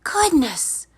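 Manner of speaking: put-on high, relieved girl's voice; Kate's voice. A narrator's reading. Kate safely home after the climb, high and relieved. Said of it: "said Kate".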